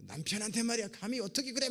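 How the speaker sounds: high, loud mock indignation; indignant scolding. Wife scolding her boastful husband, high indignant mock rebuke.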